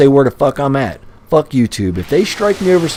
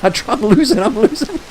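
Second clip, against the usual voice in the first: laughing; 10 semitones higher, 4 dB quieter than usual. Real, not acted. Cracking up mid-rant.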